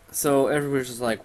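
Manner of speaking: ordinary talk, nothing marked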